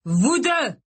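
furious father calling out; angry shout. A narrator's reading. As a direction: shout it, beside yourself. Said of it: "leur cria-t-il".

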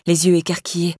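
usual speaking voice

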